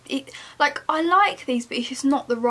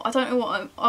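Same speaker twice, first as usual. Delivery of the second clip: ordinary delivery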